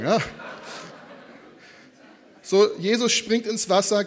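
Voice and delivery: usual speaking voice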